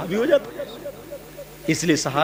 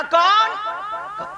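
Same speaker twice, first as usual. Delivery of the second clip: high raised call; calling from inside; much slower than usual. Retold or imitated. Aisha calling out from inside the house. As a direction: call through the closed door.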